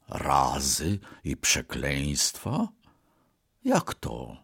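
low, soft, slow musing; talking to himself. A narrator's reading. Offended ox taking in blows and curses, murmuring to himself. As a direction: murmur it inwardly, deep and hushed. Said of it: "powiedział do siebie".

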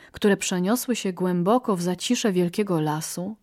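usual speaking voice

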